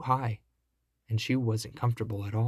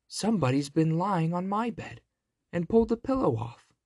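higher middle-sized bear voice; mother bear, raised pitch; 6 semitones higher than usual. A narrator's reading. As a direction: pitch it up as an indignant mama bear. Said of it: "said she in her middle-sized voice".

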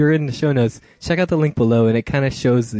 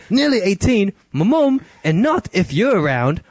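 put-on much higher, louder girlish voice; girl's voice; 8 semitones higher, 5 dB louder than usual. Host reading the girl's texts in a high girl voice.

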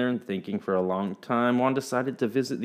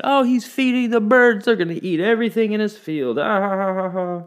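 much higher and louder, jeering with a fake laugh; mocking villagers; 11 semitones higher, 5 dB louder than usual. A narrator's reading. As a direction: ridicule him for feeding the birds. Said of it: "And the others said".

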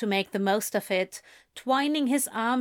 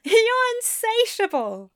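laughing fondly; 13 semitones higher, 5 dB louder than usual. A narrator's reading. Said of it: "Aziraphale said with a laugh".

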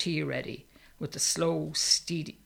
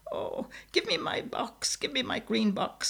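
desperate plea; 9 semitones higher than usual. A narrator's reading. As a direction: beg for it, voice pitched way up. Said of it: "springing into life".

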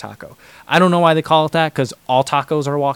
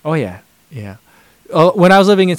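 ordinary talk

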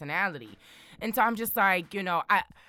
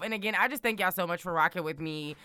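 ordinary talk

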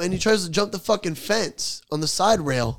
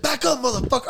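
put-on snapping back up at a drunk customer, pitched higher; angry retort; 8 semitones higher than usual. Worker threatening a drunk customer, high sharp snap. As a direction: bark it out, pitch jumping up. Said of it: "That's when I lost my shit".

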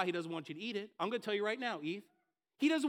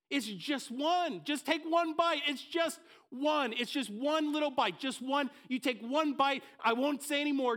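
high, pushing, stretched "It's just one"; the serpent pressing Eve, drawn out; insistent serpent; 3 semitones higher than usual. Acted. Preacher's serpent urging Eve to take one bite, insistent.

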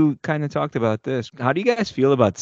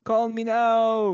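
put-on higher, slow Caribbean-accented purr; Madame Cleo; 8 semitones higher, slower than usual. A man imitates the TV psychic with a Caribbean accent. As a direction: draw out a Caribbean psychic's hotline pitch. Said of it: "do you guys remember Madame Cleo".

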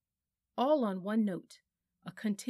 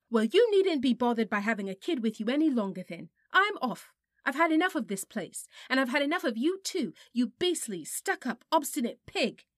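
much higher, louder furious outburst; real rage; 5 semitones higher, 8 dB louder than usual. A narrator's reading. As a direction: explode at him in fury. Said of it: "who was now in a real rage".

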